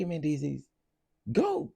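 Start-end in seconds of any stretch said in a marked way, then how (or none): 1.3-1.7 dismissive send-off. Real, not acted. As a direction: wave them off with one sharp high word. Dismissing the couple off the show.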